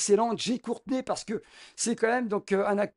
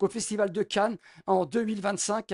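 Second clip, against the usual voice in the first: usual speaking voice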